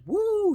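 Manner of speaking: high, loud wolf-whistle woo; husband whooping loudly at his bent-over wife. Retold or imitated. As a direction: whoop at the sight of her. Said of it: "the man looks over and goes".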